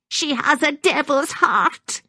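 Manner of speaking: slow, high-pitched denunciation; accusing old woman. A narrator's reading. Maggie denounces Constance to Gordon, accusing high voice. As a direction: denounce her slowly, high in the voice.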